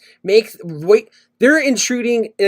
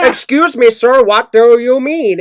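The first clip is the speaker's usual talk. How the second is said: imitated Indian accent; 3 semitones higher, 4 dB louder, slower than usual. Caller questioning the insult to his accent, mock Indian accent. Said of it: "And then he's like".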